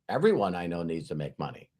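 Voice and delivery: soft, low answer after a sigh; sigh, then admit it quietly and low; retold sighing admission.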